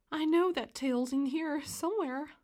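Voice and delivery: ordinary delivery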